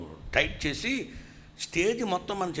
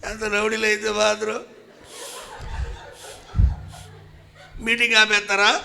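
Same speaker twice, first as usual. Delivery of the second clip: laughing protest; 8 semitones higher, 6 dB louder than usual. Retold or imitated. Pastor protesting, retold.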